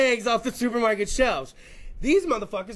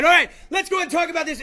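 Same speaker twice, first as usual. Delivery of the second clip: loud restart; 6 semitones higher, 6 dB louder, faster than usual. Real, not acted. Shouting to restart the bit.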